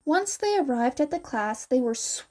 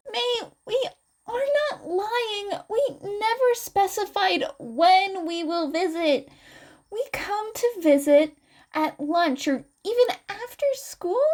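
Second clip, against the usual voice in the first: higher and gentle, calming her down; Izuku explaining; 9 semitones higher than usual. Acted. Izuku calms an upset Mei, higher gentle explaining.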